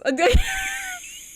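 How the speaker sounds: squealing cackle, way up high; high shrieking laugh. Real, not acted. Player cracks up at Claude, high squealing laugh. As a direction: let the laugh fly up into a squeal.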